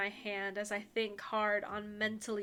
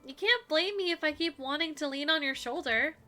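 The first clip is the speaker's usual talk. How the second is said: put-on high, bright girlish heroine; 5 semitones higher than usual. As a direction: lean in sweetly, pitched high. Heroine voiced with music.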